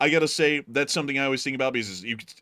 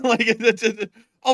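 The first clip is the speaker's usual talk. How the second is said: laughing; 10 semitones higher, 6 dB louder than usual. Real, not acted. Laughing at map.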